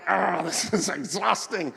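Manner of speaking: slow, low-energy groaning complaint; mock exhaustion. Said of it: "and you're like".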